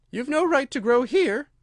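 high, loud indignant protest; indignant Dormouse. A narrator's reading. Dormouse objects to Alice growing in court, high and indignant. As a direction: scold in a high character voice.

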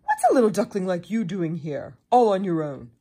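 loud, lightly voiced beaver; big beaver. A narrator's reading. Beaver questions the lone duckling, loud and brisk. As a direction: ask loudly as a big furry animal.

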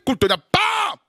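high shouted bam; booming creation thunder for the congregation. Retold or imitated. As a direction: slam out the bam.

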